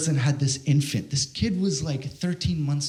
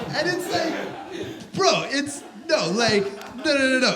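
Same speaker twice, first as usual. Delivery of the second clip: laughing disbelief; 9 semitones higher, slower than usual. Real, not acted.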